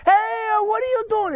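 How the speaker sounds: high-pitched imitated Italian-accented challenge; mock Italian accent. Mafia wife challenging a car thief, high Italian-accented yell. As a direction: call out in a put-on Italian accent. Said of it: "In a real Italian accent".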